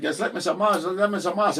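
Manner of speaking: loud, high mock-German gibberish. Joking that everybody suddenly talks German, mock German. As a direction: put on fake German as a silly catchphrase. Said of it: "started talking German out of nowhere".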